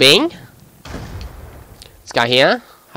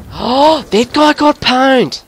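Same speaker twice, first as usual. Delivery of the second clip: excited crowing; 8 semitones higher than usual. Real, not acted. Gamer reacting to a kill, excited crowing.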